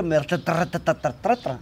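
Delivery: stuttering over the syllable tra as if tripping on the page; mock a reader who gets tangled, repeating tra; imitating stumbling reading. A friend who stumbles when reading aloud, mimicked stutter. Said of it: "me trabo mucho cuando leo".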